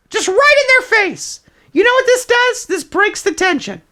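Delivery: normal talking voice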